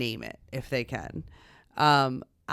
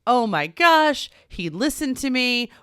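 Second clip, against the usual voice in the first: high, drawn-out, loud gushing; elated parent gushing; 9 semitones higher, 6 dB louder, slower than usual. Retold or imitated. Mom marveling that her son listened to her, gushing. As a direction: swoon with pride, stretching the words. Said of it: "flying high in parenting".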